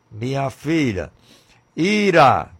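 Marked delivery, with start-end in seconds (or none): 1.8-2.5 ira shouted out loud and high; fiery pulpit cry of ira; shouted anger. Acted. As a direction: burst out with ira. Preacher shouts the word anger.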